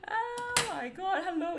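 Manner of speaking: high squealing laugh into oh my God; laughing delight at showing new nails. Real, not acted. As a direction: giggle breaking into the words. Laughing at her own nails.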